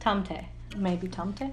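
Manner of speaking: "Tomte" said quietly as TOM-teh; "Tomte" softly as TOM-teh; soft pronunciation. Shown as a demonstration. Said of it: "Swedish listeners, please tell us".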